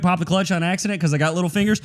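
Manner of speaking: normal talking voice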